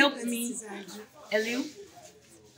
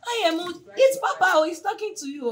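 higher, louder startled realisation; surprised; 9 semitones higher, 6 dB louder than usual. Retold or imitated. Daughter realising Papa is speaking, voice jumping up. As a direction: gasp it out, caught off guard. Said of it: "So I was like".

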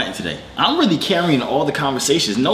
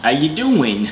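mock English accent greeting; put-on English accent; much slower than usual. Greeting an English caller, put-on English accent. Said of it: "I've got an accent too".

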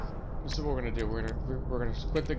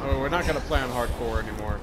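exasperated; 8 dB louder than usual. Real, not acted. Giving up after dying.

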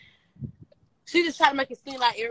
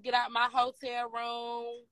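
slow, drawn-out get out order; slow mock order; 2 semitones higher, 4 dB quieter, slower than usual. Imitating LaRue ordering the girls out, slow and drawn out. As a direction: stretch each word out like an eviction. Quoting LaRue kicking them out.

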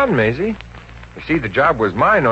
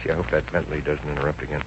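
muttered aside; 8 semitones lower, 8 dB quieter, faster than usual. Acted. Aside to himself.

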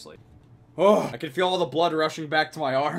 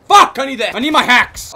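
loud, high furious yell; real rage at the game; angry outburst; 8 semitones higher, 13 dB louder than usual. Real, not acted. Gamer raging at missed clip.

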